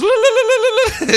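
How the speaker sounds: high, loud, trilled "la-la-la" battle yell; shrill-pitched rapid warble of a comic attacker; wild war cry. Retold or imitated. Huntress charging at Superman with knives, wild war cry. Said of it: "And Huntress goes".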